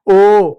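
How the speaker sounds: ओ called out loud and clear; teacher calling out the vowel; loud vowel demo. Shown as a demonstration. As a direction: belt out ओ for the class to repeat. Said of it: "इस तरीके से ओ का प्रयोग करते हैं।".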